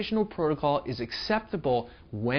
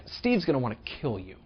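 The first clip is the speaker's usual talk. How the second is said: usual speaking voice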